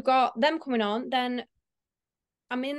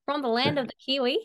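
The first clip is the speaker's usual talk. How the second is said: attempted New Zealand accent; 3 semitones higher, faster than usual. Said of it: "should I attempt to do an accent?".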